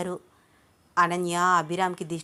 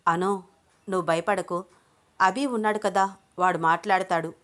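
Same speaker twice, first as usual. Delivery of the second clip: ordinary delivery, nothing marked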